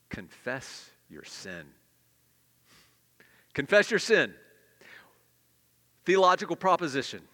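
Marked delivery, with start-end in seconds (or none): 0.1-1.7 slow, low and soft, each word laid down. Shown as a demonstration. Preacher stating his third point to the church, slow and soft. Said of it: "Number three". 3.5-4.3 louder and higher, driven home; loud repeat. Shown as a demonstration. Preacher repeating his point to the congregation, loud and emphatic. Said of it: "Number three".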